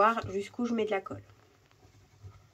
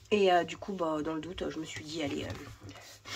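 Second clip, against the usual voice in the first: blocked nose; 4 semitones lower, 5 dB quieter than usual. Real, not acted. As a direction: sniffly voice with a head cold. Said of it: "pardon, je renifle toujours".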